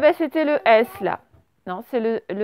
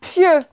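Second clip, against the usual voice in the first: pieux said higher and louder, falling; dictated word; 5 semitones higher, 6 dB louder than usual. Shown as a demonstration. Teacher dictating pieux to the class, emphatic. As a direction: stress pieux clearly for the pupils. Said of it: "Vous écrivez le mot".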